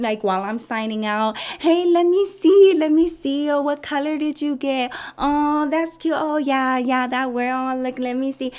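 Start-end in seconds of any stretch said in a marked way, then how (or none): 1.6-8.5 high, sing-song put-on girly voice; fawning receptionist. Receptionist admiring the son's braces, fawning. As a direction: coo in a mock-sweet high pitch.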